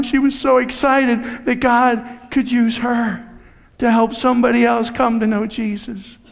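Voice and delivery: ordinary delivery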